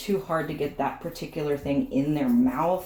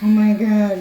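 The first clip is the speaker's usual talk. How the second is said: much louder voiced-over dog panic; dog's mock outcry; 3 semitones higher, 11 dB louder, slower than usual. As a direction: shout it as the dramatic dog. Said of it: "She's like".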